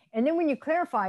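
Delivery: ordinary talk, nothing marked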